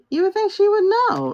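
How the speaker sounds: high, teasing sarcastic question. Real, not acted. Sarcastic aside about her knowing.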